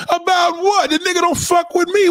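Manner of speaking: high-pitched indignant protest; indignant retort. Acted. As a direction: throw it back, pitch way up. Acted retort to relative's call.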